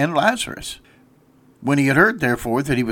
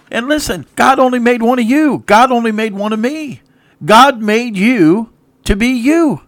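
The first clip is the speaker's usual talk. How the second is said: louder and higher, preaching hard from the pulpit; full-force preaching; 9 semitones higher, 5 dB louder than usual. Acted. Preacher urging his radio listeners, at full force. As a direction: drive each line home like a revival preacher.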